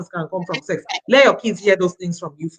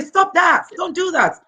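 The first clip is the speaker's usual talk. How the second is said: high sharp mock scolding; neighbour scolding a child; 8 semitones higher than usual. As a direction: lightly play a neighbour telling off a kid.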